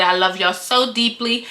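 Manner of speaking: ordinary delivery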